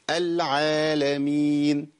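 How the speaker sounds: العالمين with alif held two counts; correct madd. Shown as a demonstration. Teacher giving correct example of vowel length. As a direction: stretch the aa of العالمين evenly. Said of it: "أجيب الأمثلة".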